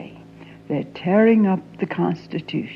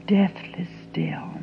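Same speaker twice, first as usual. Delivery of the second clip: whisper; 6 dB quieter than usual. Acted. Hushed memory of stillness.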